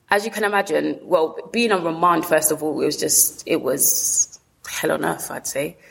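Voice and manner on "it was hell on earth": usual speaking voice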